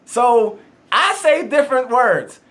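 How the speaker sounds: usual speaking voice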